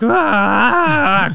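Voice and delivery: high, loud strangled "Ah"; imitated pained cry. Wounded naked man looking up at the soldier, high pained cry. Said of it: "he just looks up and he's like".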